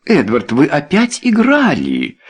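high, wailing reproach; moaned dismay. A narrator's reading. Wife realising her husband gambled, moaning. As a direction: moan it in distress. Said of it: "застонала леди Белвиль".

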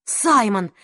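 ordinary delivery, nothing marked

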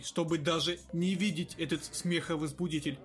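ordinary delivery, nothing marked

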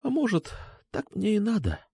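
high, small, soft voice; hushed. A narrator's reading. Алёна blames herself to Сергей, hushed. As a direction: murmur it high and resigned. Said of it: "прошептала она".

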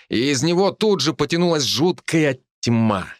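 plain reading voice

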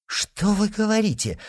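high, shocked question; indignant exclamation. A narrator's reading. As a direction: exclaim in disbelief. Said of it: "воскликнула королева".

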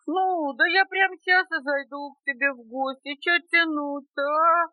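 high, pushy bear voice; lowing, mumbling bear. A narrator's reading. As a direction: moo it out like a lumbering bear. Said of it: "промычал медведь".

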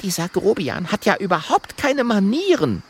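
high, hushed indignation; high-pitched angry undertone; furious whisper. A narrator's reading. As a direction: hiss it crossly in a high voice. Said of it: "Wütend flüstert Mathilda".

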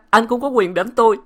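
high, loud tearful protest; crying out. A narrator's reading. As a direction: sob it at him. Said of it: "khiến Thanh khóc òa".

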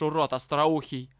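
centaur's threat. A narrator's reading. Centaur threatens the dark elf.

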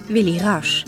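ordinary talk

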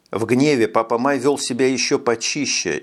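usual speaking voice

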